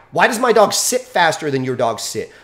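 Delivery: usual speaking voice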